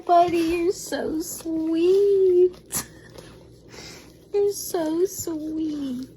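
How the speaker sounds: high, tearful voice breaking with happy sobs; overwhelmed happy tears, voice cracking high; emotional crying reaction. Real, not acted. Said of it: "and this was my reaction".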